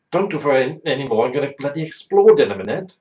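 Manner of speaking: mock panic at the nurses, loud; retold protest. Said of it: "I said".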